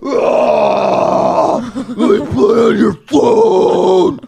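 high, slow, whiny plea; begging kid. Retold or imitated. S0 mimics kids begging for a phone, slow high whine. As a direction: drag out a spoiled child's begging.

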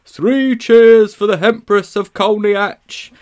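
slow, high, loud mock cheer; mocking crowd cheer. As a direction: bellow it like a jeering crowd.

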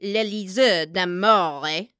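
loud, drawn-out "L'Élysée d'Amour" with a trilled R; slow, accusing "d'Amour" with rolled R; aggressively rolled R. A narrator's reading. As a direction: spit out "L'Élysée d'Amour", rolling the R hard. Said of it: "rolling the R aggressively".

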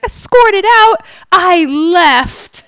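high, sharp, offended denial; indignant Dorinda. Retold or imitated. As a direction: scoff it like an insulted diva. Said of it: "And she's like".

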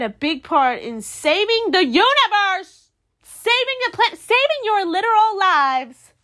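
high, emphatic, building outrage; impassioned fan telling off the banker; indignant rant. Pretend. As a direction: hammer home the injustice, rising in pitch. Arguing Sam's merit to the banker.

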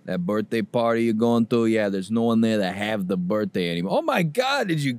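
normal talking voice

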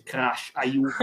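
slow, villainous hologram voice; Cortex voice. Retold or imitated. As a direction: drawl it out like a scheming scientist. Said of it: "gli ologramma di Cortex che dice".

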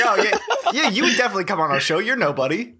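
high, laughing mock quote; mocking imitation. Co-host mocking his friend for slighting a guest, sarcastic.

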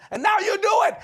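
very high, loud acted wife; shouted accusation. Wife catching husband speaking in tongues, shouted accusation. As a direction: fling it at him, high and loud.

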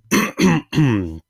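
a loud, high clearing of a tired throat; hoarse voice clearing, then back to talking. Real, not acted. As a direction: clear the throat loudly before going on. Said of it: "My voice was wearing out".

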